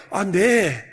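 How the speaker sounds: usual speaking voice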